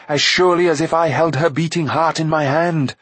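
lightly voiced villainess making a threat; menacing sorceress. A narrator's reading.

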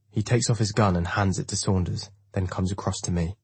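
ordinary delivery

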